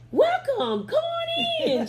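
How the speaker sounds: high, loud, stretched-out greeting; excited welcome. Retold or imitated. Usher greeting a newcomer at the church door, excited welcome. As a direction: imitate an overjoyed greeter, sailing way up in pitch. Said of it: "She was all excited".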